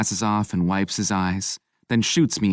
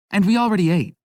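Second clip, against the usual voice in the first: flustered; 12 semitones higher, 5 dB louder, faster than usual. A narrator's reading. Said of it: "Owen adds quickly".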